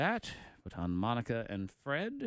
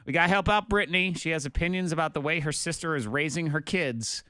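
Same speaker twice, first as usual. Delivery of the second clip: upbeat on-air host plugging the next segments; radio promo read; 3 semitones higher, 9 dB louder than usual. Acted. Morning radio host hyping the Facebook Live stream, punchy announcer read.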